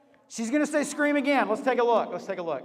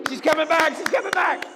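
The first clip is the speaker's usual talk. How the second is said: loud, high comeback call; excited shout; 5 semitones higher, 5 dB louder than usual. Real, not acted. Game host cheering on Lexi's comeback, excited shout. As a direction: whip up the crowd, rising higher on the repeat. Host rallies crowd for contestant.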